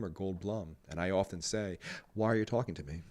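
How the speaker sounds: low, hushed celebrity impression; Jeff Goldblum. Host redoing a clip for his co-host, Jeff Goldblum impression. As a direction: drop down soft and deliberate, with pauses. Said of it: "All right, wait, I think I can do it".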